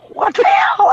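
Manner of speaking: high, cracking shout of disbelief; husband's shocked shout. Retold or imitated. Husband on phone hearing wife is arrested, shocked high shout. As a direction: yell it like the news just floored you.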